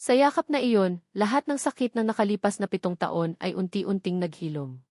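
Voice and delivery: plain reading voice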